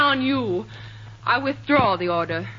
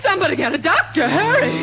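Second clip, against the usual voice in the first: panicked plea; 6 semitones higher, 4 dB louder, faster than usual. Acted. Teacher thinks Bill was shot.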